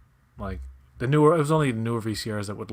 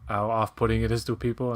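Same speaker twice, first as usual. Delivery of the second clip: ordinary talk, nothing marked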